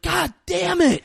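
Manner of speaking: high, loud mock outburst of frustration; mock angry yell. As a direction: yell it like a furious movie villain. Excited recap of movie's angry character.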